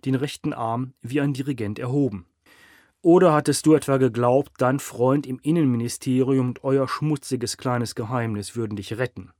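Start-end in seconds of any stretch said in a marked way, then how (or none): 3.0-9.3 declaiming. A narrator's reading. Boss delivering a mock eulogy to the doomed mayor, declaiming. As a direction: deliver it like a rehearsed speech. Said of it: "deklamierte Kiel".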